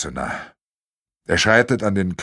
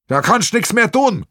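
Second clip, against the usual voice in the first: higher, loud call; calling over; 10 semitones higher, 3 dB louder than usual. Acted. Bernhard calls over to the old inn, loud and high. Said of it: "ruft der Bernhard".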